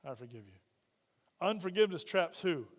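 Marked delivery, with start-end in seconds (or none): 0.0-0.6 low and very soft, almost to yourself; a heavy, quiet I forgive you; quiet forgiveness. Shown as a demonstration. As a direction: murmur it as though it costs you. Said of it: "pushing the button saying".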